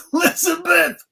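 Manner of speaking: high strained cry to the sky; Red Fox voice. Retold or imitated. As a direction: wail the name like a sitcom dad. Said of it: "your Red Fox voice".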